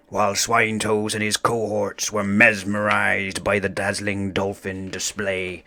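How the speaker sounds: ordinary delivery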